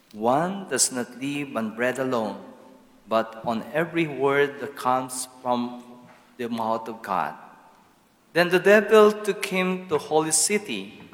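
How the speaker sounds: usual speaking voice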